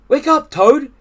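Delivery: high, loud wake-up call; Frog's voice. A narrator's reading. Frog wakes Toad from his dream, high voice. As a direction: rouse a sleeping friend loudly.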